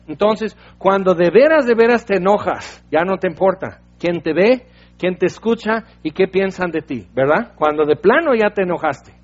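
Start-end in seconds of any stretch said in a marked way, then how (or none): none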